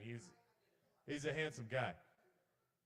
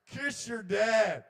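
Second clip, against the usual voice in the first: very loud, high, drawn-out yell; screaming intrusive thought; 10 semitones higher, 11 dB louder, much slower than usual. Acted. Intrusive thought drowns out dad's small talk, slow high yell. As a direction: bellow it slowly as an overpowering urge. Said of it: "my intrusive thoughts were like".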